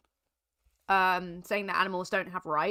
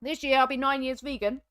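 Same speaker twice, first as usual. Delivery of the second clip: usual speaking voice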